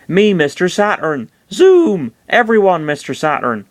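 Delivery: high cartoon creature voice; excitable high critter voice; odd little creature. A narrator's reading. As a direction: pipe up high, far from monotone. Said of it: "the creature replied in monotone".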